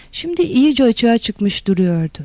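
ordinary delivery

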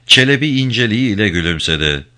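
usual speaking voice

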